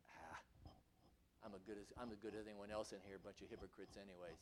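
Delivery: muttered fast and low, dismissive; scoffing mutter. Retold or imitated. Preacher voicing Esau's brush-off, scoffing mutter. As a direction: sneer it off under your breath. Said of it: "That's his attitude".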